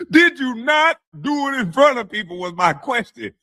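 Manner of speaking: high, insistent mock interrogation; high-pitched imitation of a scolding question; mimicked indignant questioner. Mimics the questioner from played clip.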